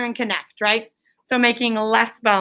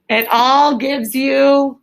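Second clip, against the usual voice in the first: drawn-out prompt; 2 semitones higher, 4 dB louder, much slower than usual. Real, not acted. Teacher drawing out a fill-in prompt.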